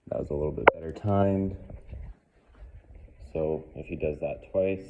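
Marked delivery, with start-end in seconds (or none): none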